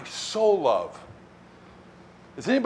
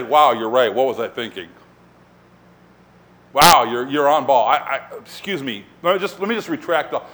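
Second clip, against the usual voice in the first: low-pitched, hammed-up backing down; mock contrition; 6 semitones lower than usual. Imagined Jesus giving in to Peter, mock-apologetic. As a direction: pretend to be meekly corrected.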